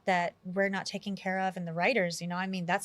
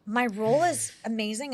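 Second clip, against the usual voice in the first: excited; 4 semitones higher, 5 dB louder than usual. Real, not acted. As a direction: gush with a laugh. Raving about the movie.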